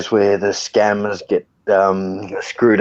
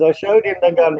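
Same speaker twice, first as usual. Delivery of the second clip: higher-pitched mock Indian robber; Indian bank robber; 8 semitones higher than usual. Retelling a comedian's bank robbery skit, high mock-Indian robber voice. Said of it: "the Indian bloke that walks in and goes and robs the bank".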